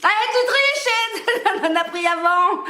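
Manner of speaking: laughing accusation. Real, not acted. Accusing partner of cheating.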